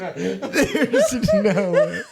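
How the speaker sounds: talking through big laughter; can barely get words out laughing; laughing hard. Real, not acted. Cracking up at co-host's slip.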